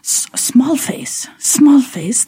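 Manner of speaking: hesitant imitation of an admiring coworker; Korean coworker. Korean coworker remarks on Rebecca's face, lightly imitated. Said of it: "she's like".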